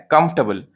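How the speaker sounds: "कंफर्टेबल" shortened to KUMF-tuh-bul; right pronunciation. Shown as a demonstration. Teacher tells what it is called, "कंफर्टेबल" as kumf-tuh-bul. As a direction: drop the "er", reduced ending in "कंफर्टेबल". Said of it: "ये right pronunciation है".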